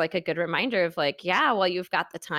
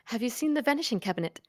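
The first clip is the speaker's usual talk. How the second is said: mock British accent, fast and lightly raised; imitating the film actors' British take on "vanishing cabinet"; light British film-voice parody; British accent impression; 3 semitones higher, faster than usual. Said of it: "The way they say it is".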